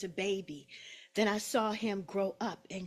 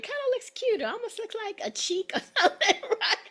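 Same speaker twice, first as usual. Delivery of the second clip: laughing; 13 semitones higher, 7 dB louder, faster than usual. Real, not acted. Joking about her swollen cheek.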